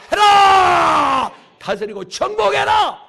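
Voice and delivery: loud, drawn-out order rising high; booming imperative with a stretched ending; shouted command. Acted. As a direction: command them at full preaching force. Preacher voices a divine command.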